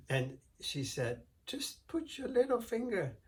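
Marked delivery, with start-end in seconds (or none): 1.5-3.2 high, sugary, innocent voice; sweet innocent wife. Acted. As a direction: play it sweet and harmless to fool him. Wife luring the giant.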